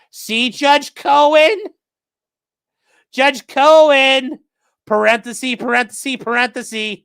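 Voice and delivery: sing-song mocking call; mocking whiny voice. Mocking plaintiff's lawyer.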